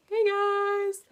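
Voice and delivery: high breezy mock greeting; lightly voiced celebrity greeting. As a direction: imitate him crashing the panel. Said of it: "It was like".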